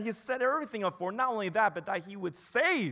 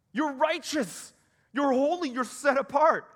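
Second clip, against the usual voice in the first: jubilant declaration; 7 semitones higher, 5 dB louder than usual. Pretend. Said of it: "But rather, he says".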